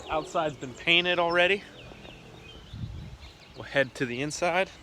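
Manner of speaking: normal talking voice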